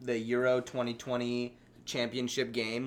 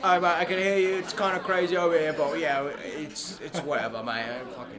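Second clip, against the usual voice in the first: hammed-up British accent, a bit higher and louder; British lad on a call from London; mock London lad; 5 semitones higher, 5 dB louder than usual. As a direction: play a rattled English football fan. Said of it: "[name], are you with us".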